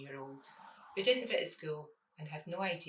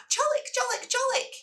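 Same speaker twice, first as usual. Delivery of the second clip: put-on excited toddler; 7 dB louder than usual. Middle child spots chocolate buttons.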